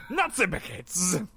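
slow, hammed-up French dismay; French accent. Fellow French mobster lamenting the bread, slow mock French. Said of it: "it's the French mafia".

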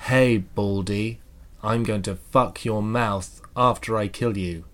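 slow, drawn-out menace with a stilted laugh; mock death threat. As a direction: read it like a sinister ransom note, very slowly.

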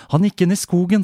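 put-on a boy telling his mother in alarm; scared boy's answer. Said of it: "sa Lucky". (redd gutt, lys og sterk stemme)

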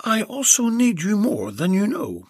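a breathy-sounding secret thought; Belinda's voice. Acted.

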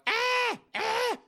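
put-on very high shrill old mother; high falsetto mama pleading. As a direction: squeal like a panicked mom. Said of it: "Mama's there in the hallway going".

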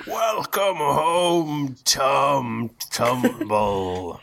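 booming loudspeaker voice; slow, high, booming announcement; booming thunder and wind. Acted. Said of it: "a booming voice over the loudspeaker".

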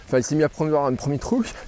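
normal talking voice

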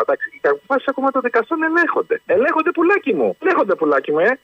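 high, mocking sing-song; sarcastic mimicry. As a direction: sneer it back at him. Caller mocks minister.

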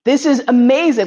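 excited delight. Retold or imitated. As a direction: shout it out, thrilled. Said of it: "And I was like".

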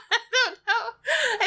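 high, slow words through laughing; helpless laughter. Real, not acted. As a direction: squeak it out while laughing. Cracking up over own accent.

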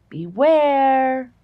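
loud, high, spooky warning; dramatic warning. Shown as a demonstration. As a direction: boom it like a movie narrator. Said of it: "I don't even wanna say it like that".

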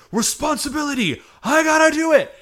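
high, loud heroic shouting; hyper energetic. Retold or imitated. Hero leaps up as the alert goes off, hyper energetic. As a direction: act out an over-eager hero charging off. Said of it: "he is hyper energetic now".

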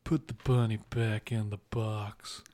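put-on slow, low drawled Southern Cage accent; slow Southern drawl like Nicolas Cage; Nicolas Cage impression. Friend quoting Con Air's bunny line, slow Southern Cage drawl. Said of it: "you were on the money".

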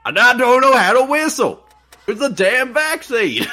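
high loud ranting voice; hammy high swamp rant; mock crazy local. Mocking a movie character.

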